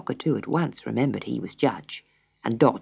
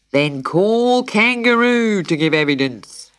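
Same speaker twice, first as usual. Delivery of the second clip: loud, drawn-out official summons; pelican's cry; 6 semitones higher, 6 dB louder than usual. A narrator's reading. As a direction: call it out across the court. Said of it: "cried the pelican".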